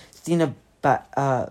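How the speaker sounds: usual speaking voice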